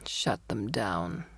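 low, slow slurred order; a drunk man muttering an order; low slow drunken voice. A narrator's reading. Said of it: "He sounded drunk".

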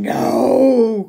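high, loud creature cry; troll voice. Acted. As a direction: shout like a desperate troll. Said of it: "Your troll voice is on point".

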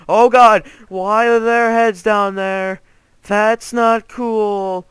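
high, very loud, drawn-out cry of shock; alarmed shout. Real, not acted. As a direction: yell in horror, stretching the words. Shocked by heads in the game.

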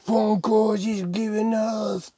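high, slow showman's delivery; drawn-out hype. Pretend. Host presenting Funko cereal to viewers, high drawn-out hype. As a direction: stretch it out high for suspense. Hyping cereal being poured.

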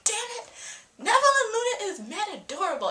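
high-pitched fangirl exasperation; mock frustrated outburst. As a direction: squeal it in playful frustration. Acts out her frustration over the couple.

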